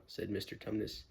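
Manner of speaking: ordinary delivery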